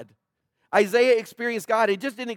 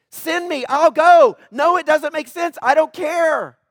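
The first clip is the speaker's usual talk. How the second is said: high and loud, fervent; fervent, loud, reckless surrender; bold declaration; 6 semitones higher, 7 dB louder than usual. Retold or imitated. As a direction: throw caution away and shout it to God. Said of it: "You'll say crazy stuff to God".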